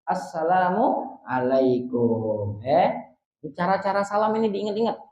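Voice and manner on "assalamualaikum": Assalamualaikum said slowly in one unbroken breath; slow connected demo. Shown as a demonstration. Teacher shows joined greeting, slow and unbroken. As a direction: join Assalamualaikum smoothly, no gap.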